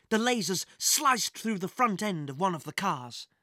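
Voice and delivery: usual speaking voice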